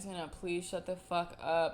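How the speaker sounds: telling yourself off quietly; inner voice under breath. Retold or imitated. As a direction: hush it like a thought in your head. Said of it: "I literally will have moments where I'm like".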